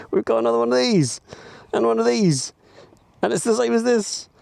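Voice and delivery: high, fast, delighted show and tell; excited. Real, not acted. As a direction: gush over the new toys. Excited about new gadgets.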